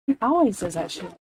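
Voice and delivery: ordinary delivery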